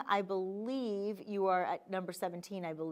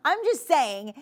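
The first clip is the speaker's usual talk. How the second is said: slow laughing, a touch higher and louder; 7 semitones higher, 7 dB louder, slower than usual. Real, not acted. Host laughing at her own peppermint joke. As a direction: laugh through it, amused.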